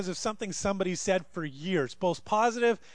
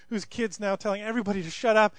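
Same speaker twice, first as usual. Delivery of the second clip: ordinary talk, nothing marked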